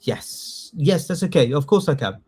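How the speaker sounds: usual speaking voice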